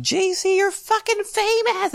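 squealing high fan voice, slowed; excited fan. Retold or imitated. Fan messaging the host, high and gushing. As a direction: gush like a starstruck fan. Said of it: "who were like".

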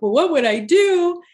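high, plaintive mock wail; retold tearful wail. Crying to girlfriends about quitting her job, retold wail. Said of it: "I'm crying and I'm like".

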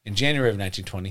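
fast, fast and low after laughing; composing himself after laughing. Real, not acted. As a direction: rush through it to stay composed. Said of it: "let me collect myself".

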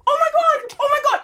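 high, frantic oh my God; panicked cry. Retold or imitated. At the crash scene, the driver in a high panicked cry. Panicking after hitting a biker.

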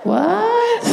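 high disbelieving squawk; high shocked what. Retold or imitated. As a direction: blurt it out in total disbelief. Reacting to the broken speculum.

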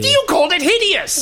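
high, wounded protest; indignant accusation. Acted. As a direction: throw the insult back at a friend. Accuses friend over insult.

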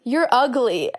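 tossing the insult back in a lightly voiced tone; mock insult. Friend repeats the insult back in a lightly mocking voice.

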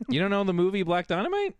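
high-pitched disbelief at normal volume; can't believe they don't know it; incredulous outburst. Real, not acted. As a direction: voice jumps up in mock-scolding surprise. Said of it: "Don't yell at me".

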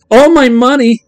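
put-on high, loud wailing kid; crying kid. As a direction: cry out the loss. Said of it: "he cried".

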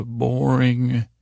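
normal talking voice

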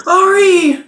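shrieking the name, very high; shout. Acted. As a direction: scream her name in relief. Healer wakes fallen friend.

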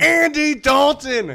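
high, loud, slow big reveal; excited reveal. Acted. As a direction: announce the winner with gusto. Game show reveal.

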